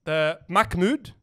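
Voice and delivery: "Mahmoud" with a hard k, MACK-mood; slow "Mahmoud", h turned into k; common mispronunciation. Shown as a demonstration. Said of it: "There's another common one".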